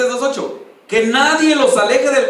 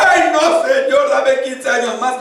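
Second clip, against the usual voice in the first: drawn-out, louder whiny plea; mock pleading; 3 semitones higher, 3 dB louder, slower than usual. Weak believer begging God for more years, mock pleading. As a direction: beg slowly, hammed up.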